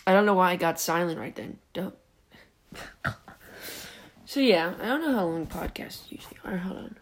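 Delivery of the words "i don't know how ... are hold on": whisper. Real, not acted. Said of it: "unvoiced aside".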